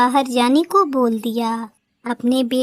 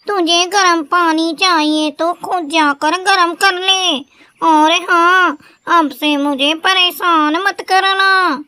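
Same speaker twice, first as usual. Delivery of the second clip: put-on higher, loud, scornful bahu shouting down the old woman; rude daughter-in-law; 5 semitones higher, 4 dB louder than usual. A narrator's reading. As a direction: tell the old woman off with contempt. (घमंडी बहू की ऊँची, तीखी डाँट)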